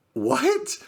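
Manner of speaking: very high, rising disbelief; squeaked baffled question; dumbfounded. Retold or imitated. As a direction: replay total confusion. Stunned again by client's glee.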